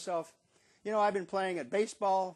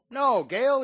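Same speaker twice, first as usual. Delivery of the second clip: quoted dad; 9 semitones higher, 10 dB louder than usual. Retold or imitated. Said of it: "he says".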